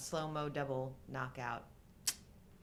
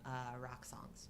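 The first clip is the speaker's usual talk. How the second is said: joking whisper; 4 semitones lower, 11 dB quieter, slower than usual. Acted. Joking aside about movie formula.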